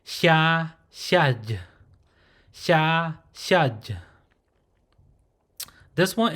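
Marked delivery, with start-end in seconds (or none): 0.0-1.6 "xia" as breathy hissed ya, "xiaj" closed off; breathy start on "xia" and "xiaj"; aspirated ya demonstration. Shown as a demonstration. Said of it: "This consonant is pronounced". 2.5-4.0 "xia" as breathy hissed ya, "xiaj" closed off; breathy start on "xia" and "xiaj"; aspirated ya demonstration. Shown as a demonstration. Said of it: "This consonant is pronounced".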